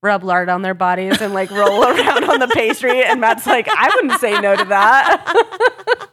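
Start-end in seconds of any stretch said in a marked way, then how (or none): 1.5-6.1 high, loud, breaking into laughter; laughing. Real, not acted. Co-host retelling a funny bakers' moment, laughing. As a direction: crack up while telling it.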